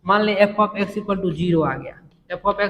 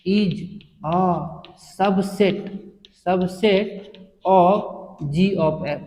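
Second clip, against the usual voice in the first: word by word, very slow; slow read-out; much slower than usual. Shown as a demonstration. Teacher reading the theorem aloud to students, very slow and deliberate. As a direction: spell out the statement slowly for the class. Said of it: "कैसे पढ़ेंगे?".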